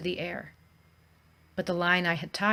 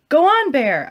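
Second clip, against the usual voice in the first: higher, louder yell; shouted; 7 semitones higher, 9 dB louder than usual. A narrator's reading. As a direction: shout to scare it off. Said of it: "I shouted".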